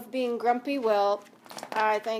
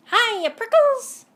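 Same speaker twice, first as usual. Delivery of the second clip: much higher, louder, slow cheeky greeting; jackrabbit shouting; 12 semitones higher, 10 dB louder, slower than usual. Acted. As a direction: shout a teasing hello. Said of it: "she shouted".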